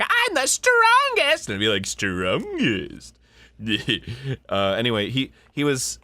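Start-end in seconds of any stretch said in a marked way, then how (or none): 0.0-1.5 very high, loud, slow villainess boast; Morbidia voice. Retold or imitated. Voice actor imitating his co-star's sorceress boasting, very high and loud. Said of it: "so she'll be like". 1.9-3.2 a lightly voiced dim echo; Gato echoing. Sidekick Gato echoing his partner's boast, lightly voiced. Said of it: "he always repeats what Morbidia says".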